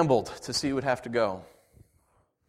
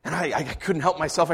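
ordinary talk, nothing marked

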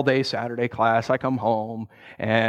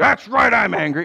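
mild mock anger; 6 semitones higher, 4 dB louder than usual. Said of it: "you are furious".

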